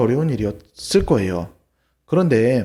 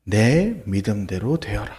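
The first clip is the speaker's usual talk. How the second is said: solemn blessing; 7 semitones lower, slower than usual. Acted. Jesus heals the blind men.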